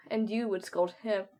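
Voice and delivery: ordinary delivery, nothing marked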